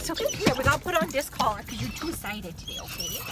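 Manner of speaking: high coaxing pet talk; calm an excited puppy; soothing dog voice. Real, not acted.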